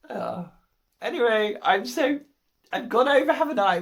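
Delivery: ordinary talk, nothing marked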